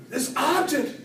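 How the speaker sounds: usual speaking voice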